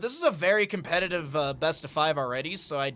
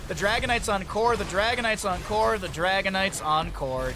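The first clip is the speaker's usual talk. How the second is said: excited call; 3 semitones higher, 4 dB louder than usual. Real, not acted. Caster announcing the core falling, loud and excited. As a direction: build to the win. Caster calling the game end.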